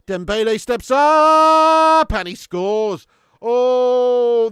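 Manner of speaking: real live goal call; dramatic loud call. Real, not acted. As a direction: drag it out then burst on scores. Penalty scored, tense moment.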